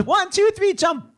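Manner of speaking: high called-out count; re-enacted countdown. Re-enacted countdown from comic bit.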